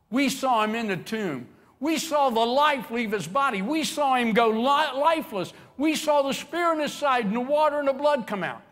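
rising, loud, high insistence building to a shout; insistent protest. Acted. Disciples insisting Jesus is dead, building to a shout. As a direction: argue it harder each line.

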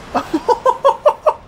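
high, loud staccato laugh; whooping laugh. Real, not acted. Player thrilled by badass boss, whooping laugh.

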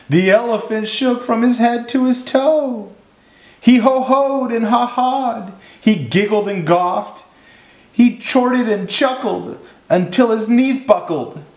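high-pitched storytelling, carried by the laugh; high, merry storybook voice; mirthful narration. A narrator's reading. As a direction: narrate high, as if caught up in giggles. Narrator tells of elephant's laughing fit.

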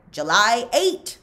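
loud, firm date thrown back; loud, stubborn answer to Magnet; stubborn insistence. A narrator's reading. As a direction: plant your feet and insist. Zigzag arguing with Magnet.

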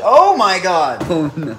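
high, loud shocked cry; real fright, loud and high; startled shriek. Real, not acted. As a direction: yelp at the scary surprise. Startled by scary package.

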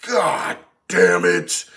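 low, drawn-out, strained exasperation without yelling; exasperated prison officer, slow and low; restrained C O Stevens. Acted. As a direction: groan it out slowly, holding back the yell. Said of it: "I can't yell at the moment".

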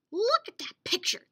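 high, loud demand. Acted. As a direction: insist they look. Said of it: "they demanded".

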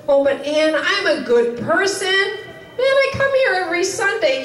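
high-pitched, self-satisfied churchgoer protesting; a light put-on of a complacent church member; mock churchgoer. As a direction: play the smug regular who thinks he's fine. Imagined churchgoer answers the preacher.

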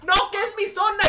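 high loud angry protest; angry yelling. Retold or imitated. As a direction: yell back defending your turf. Other vendor yells back.

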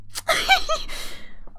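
airy giggle after apologising; a real embarrassed giggle; breathy high giggle. Real, not acted. Laughing at own outburst.

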